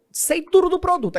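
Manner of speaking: high, loud know-it-all boast; eager salesman. Acted. Salesman boasting to a TV customer, eager and loud. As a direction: burst out high and loud, showing off.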